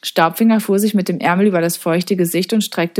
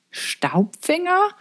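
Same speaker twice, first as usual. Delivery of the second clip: very slow, high, rising question; puzzled echo; 10 semitones higher, 3 dB quieter, much slower than usual. A narrator's reading. Girl puzzling over a strange name, puzzled echo. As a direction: wonder at a strange name, drawn out.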